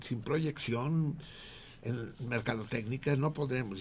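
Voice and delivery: two Galician men in a joke, mildly voiced and loud; joke voices. Acted Galician joke characters.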